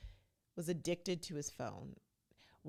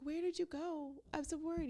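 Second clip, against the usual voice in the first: high, fretful imitation of a clingy fiancée; mock anxious Chelsea; 12 semitones higher than usual. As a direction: mimic her worried whine, pitched up. Mimicking Chelsea's anxious questions.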